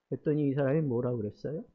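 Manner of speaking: usual speaking voice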